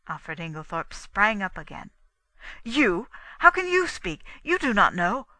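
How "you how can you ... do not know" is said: loud, high incredulous outburst; agitated, broken off. A narrator's reading. Inglethorpe springing up to challenge Poirot, agitated. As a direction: burst out, then break off abruptly. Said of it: "he broke off abruptly".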